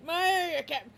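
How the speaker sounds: loud, high, breaking into laughter; giggling territorial dog; laughing dog voice. Acted. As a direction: yell it while cracking up.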